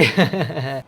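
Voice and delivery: low, soft chuckle; amused chuckle. Real, not acted. Narrator reacts to his own story twist, low amused chuckle. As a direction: chuckle quietly to yourself.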